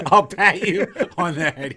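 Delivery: slow mocking echo through a laugh; laughing mock repeat. Real, not acted. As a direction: repeat it with a chuckle. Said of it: "he says".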